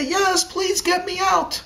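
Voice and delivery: high, drawn-out plea for rescue; pleading shout. A narrator's reading. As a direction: call back desperately from down the well. Trapped girl calls back for rescue.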